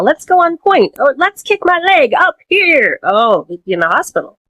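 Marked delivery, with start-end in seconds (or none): 0.0-3.0 high, airy ballerina voice; mock ballerina. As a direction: prance it like an out-of-shape dancer. Mocking an out-of-practice ballerina.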